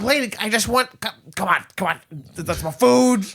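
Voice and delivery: high, halting comic whine; pleading hawk character. Acted. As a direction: stammer a plea in a high cartoon-bird voice. Hawk begging for its food back.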